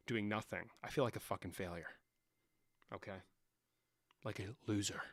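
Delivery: mock self-pity. Mopey low self-pity.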